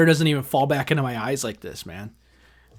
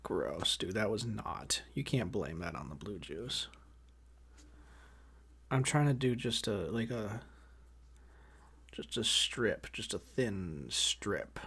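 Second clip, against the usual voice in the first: muttering quietly; 5 semitones lower, 16 dB quieter, slower than usual. Real, not acted. Muttering while fixing hair.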